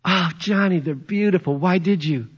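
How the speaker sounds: a lightly voiced wife, surprised by roses; wife's delighted surprise. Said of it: "Happy anniversary, Noel".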